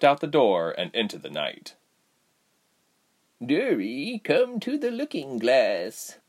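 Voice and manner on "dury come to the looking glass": put-on meek elderly woman. A narrator's reading. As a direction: call meekly like an old servant. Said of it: "an elderly voice called meekly".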